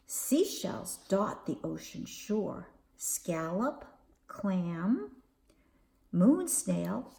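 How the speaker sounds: usual speaking voice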